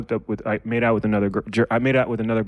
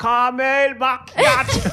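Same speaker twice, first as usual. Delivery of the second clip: high, slow Caramel macchiato in a put-on English accent; mock English accent; 11 semitones higher, 4 dB louder, much slower than usual. Shown as a demonstration. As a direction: stretch out Caramel macchiato like a posh Brit. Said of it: "that's how you do it".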